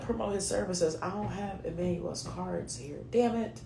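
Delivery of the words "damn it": ordinary talk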